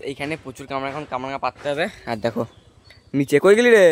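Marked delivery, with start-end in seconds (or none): 3.2-3.8 loud, higher call down from the tree. Real, not acted. From up the tree, calling loudly to the brother below. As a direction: shout down to someone below.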